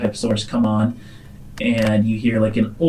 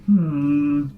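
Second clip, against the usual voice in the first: high, slow sing-song chef voice; cooking show host; 8 semitones higher, much slower than usual. Acted. AI Paige narrates her cooking, sing-song host voice. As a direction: savor each word like a TV chef.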